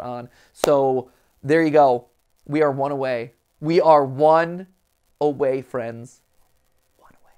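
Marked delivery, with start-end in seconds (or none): 3.6-6.2 slow dramatic. Acted. Dramatic buildup before final box. 7.0-7.3 breathy hushed whisper. Acted. Dramatic whisper.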